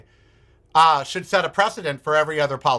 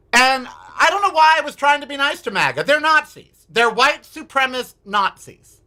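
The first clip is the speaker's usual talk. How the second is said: indignant outburst; 8 semitones higher than usual. Real, not acted. Outburst about MAGA.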